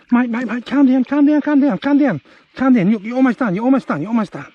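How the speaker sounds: high, urgent calming of the turtle; excited coaxing. Real, not acted.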